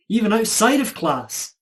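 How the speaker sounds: normal talking voice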